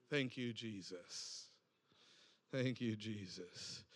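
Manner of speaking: very soft, low, slow murmur; whispered prayer. Real, not acted. Preacher murmuring thanks to Jesus, very soft and slow. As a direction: barely breathe the words, slowly.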